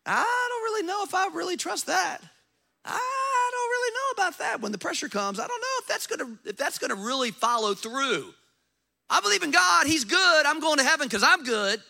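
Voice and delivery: mocking a listener who doubts the Bible; mock doubting believer. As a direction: parody a wavering churchgoer. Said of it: "they start talking about the Bible and they go".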